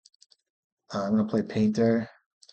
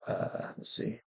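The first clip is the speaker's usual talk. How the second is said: whisper; 12 semitones higher, 14 dB quieter, slower than usual. Real, not acted. Thinking over a play.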